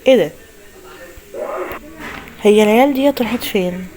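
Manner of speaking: ordinary talk, nothing marked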